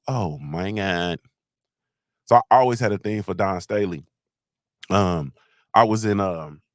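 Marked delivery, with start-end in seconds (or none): 0.1-1.2 slow, low "oh my God"; retold awe. Fan recalling her ponytail flapping in the wind, awed. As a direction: draw it out low in mock awe. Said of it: "I used to say".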